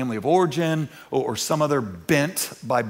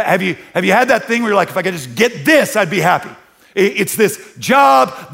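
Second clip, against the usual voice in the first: loud, high-pitched pulpit push; fiery preaching; 7 semitones higher, 9 dB louder than usual. Acted. Pastor pressing his church on competing desires, preaching loudly. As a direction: drive it home to the congregation.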